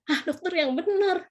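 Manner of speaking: mild amazed doubt, acted out; retold disbelief. Retold disbelief at doctor's news.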